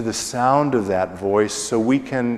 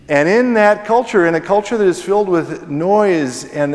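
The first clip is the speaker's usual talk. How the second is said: louder and higher, pressing the point; raised preaching voice; 8 semitones higher, 5 dB louder than usual. Real, not acted. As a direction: lift it up like a preacher hitting his stride. Preacher builds to loud emphasis.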